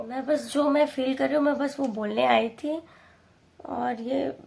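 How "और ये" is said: ordinary delivery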